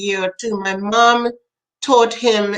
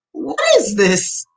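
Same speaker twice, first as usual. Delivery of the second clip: slow, higher-pitched mock amazement; retold bewilderment; 4 semitones higher than usual. Parent watching her son feed everyone lines, mock bewildered. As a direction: draw it out in baffled disbelief.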